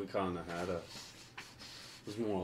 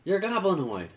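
indignant protest; 12 semitones higher, 10 dB louder than usual. Acted. Arguing in character, protesting.

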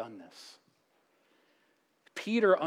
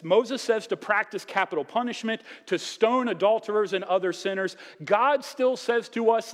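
rising preaching; 8 semitones higher, 6 dB louder than usual. Acted. Preaching at full force.

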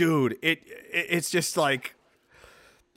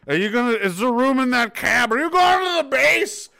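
put-on high, loud drunken pleading; 7 semitones higher, 4 dB louder than usual. Drunk begging a cab ride to base, hammed-up call. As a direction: drunk calling out, pitch climbing. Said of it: "but when you're drunk".

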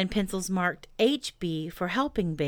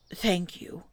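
growled. A narrator's reading. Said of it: "growled Pooh".

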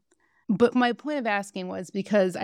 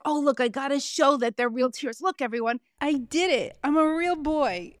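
higher, showy fake pride, slowing on the last line; mocking Sandoval; 5 semitones higher than usual. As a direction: show off your tears to everyone, mockingly. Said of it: "But he was like".

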